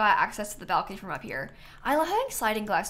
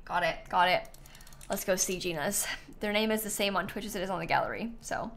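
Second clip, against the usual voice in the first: ordinary delivery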